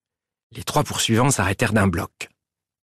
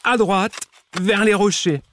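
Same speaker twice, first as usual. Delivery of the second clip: high-pitched outraged complaint; indignant; 10 semitones higher than usual. A narrator's reading. Mole lists the damage to Prosper, indignant. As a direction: reel off the grievances, indignantly. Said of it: "s'indigna la taupe".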